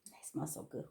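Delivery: hushed whisper of pleasure; whispered aside, savouring the smell of the peas; whispered delight. Real, not acted.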